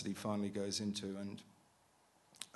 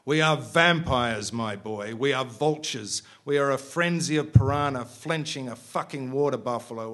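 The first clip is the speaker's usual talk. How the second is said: manic grin; 4 semitones higher, 10 dB louder than usual. Acted. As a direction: savour every word like a madman. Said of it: "with a madman's grin on his face".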